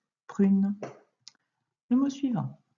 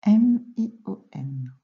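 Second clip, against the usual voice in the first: slow, separated spelling; letter by letter; 5 dB louder, slower than usual. Shown as a demonstration. Teacher correcting a pupil's dictation, letter by letter. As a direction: spell it out with gaps between letters.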